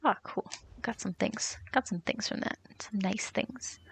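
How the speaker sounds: whispered, pleased mutter to herself; soft whisper. Real, not acted. Streamer checking her loot, whispering to herself. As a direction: murmur it like nobody's listening.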